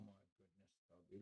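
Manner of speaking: breathy whisper so the dog can sleep. Real, not acted. Owner whispering over his tired dog. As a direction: hush your voice over a napping pet.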